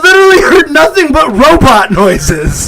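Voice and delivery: high, loud laughing complaint; laughing outburst; laughing protest. Real, not acted. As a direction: crack up while protesting.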